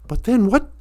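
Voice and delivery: usual speaking voice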